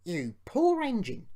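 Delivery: gently sorry for the other engine; soothing a hurt friend; sympathetic. A narrator's reading. James consoling the poor engine, sympathetic. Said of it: "sympathised James".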